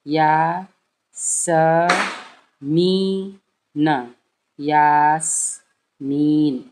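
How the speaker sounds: syllable-by-syllable reading. Shown as a demonstration. Teacher shows breaking a name into syllables, slow and loud. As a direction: break Yasmin into syllables slowly, then join. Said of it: "दो दो जोड़ने वाला formula".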